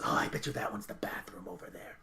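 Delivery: hushed, low aside from a companion; whispering Nicholas. Acted. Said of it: "and Nicholas goes".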